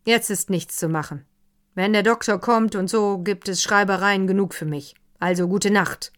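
loud, brisk and final; a gruff host ending the evening, loud; gruff loud goodnight. Acted. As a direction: wrap it up loudly. Wallbaum gruff, loud farewell.

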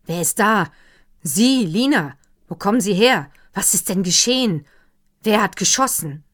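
urgent shouting. A narrator's reading. Herr von Wallbaum shouts questions at Lina. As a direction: fire questions at the maid. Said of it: "rief Herr von Wallbaum".

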